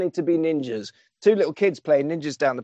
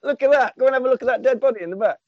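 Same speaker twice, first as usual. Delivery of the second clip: mock excited showing off; 7 semitones higher, much faster than usual. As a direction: hype it up like showing off a prize, quick and high.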